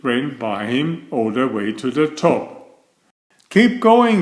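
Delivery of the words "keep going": high, loud cheer; cheering cry. A narrator's reading. Rabbit cheering Franklin up the hill, calling out. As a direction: shout encouragement up the hill. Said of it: "cried Rabbit".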